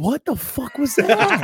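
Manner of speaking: high, slow baffled whine; stunned reply. Retold or imitated. Guy taunted on the street, high slow disbelief. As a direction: drag it out in disbelief. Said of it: "And you're like".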